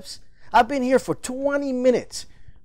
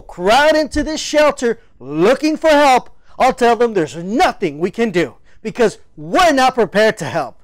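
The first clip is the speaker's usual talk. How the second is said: loud angry yelling, pitched up; furious shout; 5 semitones higher, 8 dB louder than usual. Acted. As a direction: shout them out the door in fury. Leader blows up at volunteers.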